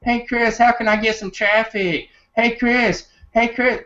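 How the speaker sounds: very high-pitched mock pleading; squeaky pack of eager askers; mock pestering fans. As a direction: pester him in a silly falsetto. Everybody's running up to 'em asking.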